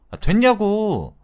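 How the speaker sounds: slow, high, loud exasperated yell; frustrated shout. Retold or imitated. Friend shouts at the wife to end the argument, slow frustrated yell. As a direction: drag it out in angry exasperation.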